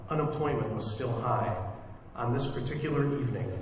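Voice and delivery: story narration. Acted. Director opens the radio play for listeners, projected story narration. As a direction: deliver it as a measured, projected prologue. Reads the drama's opening narration.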